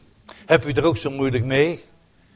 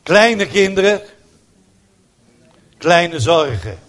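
amused saying; 5 semitones higher, 6 dB louder, slower than usual. Real, not acted. Preacher's saying, half laughed.

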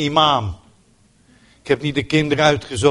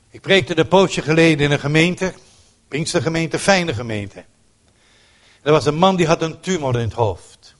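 ordinary delivery

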